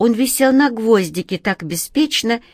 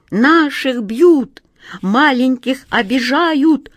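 higher, louder, slow rallying shout; rally the crowd, shouting; mice battle cry; 6 semitones higher, 4 dB louder, slower than usual. A narrator's reading. (мыши подхватывают клич, громко и протяжно)

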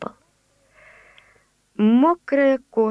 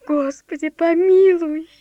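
fearful cry; 6 semitones higher, 5 dB louder than usual. Acted. Awed old servant cries out.

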